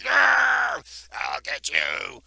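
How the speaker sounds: high roaring shout, then slow; angry gnome roar. Acted. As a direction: roar it at them. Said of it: "He's like".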